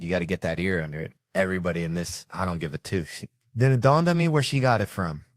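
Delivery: ordinary delivery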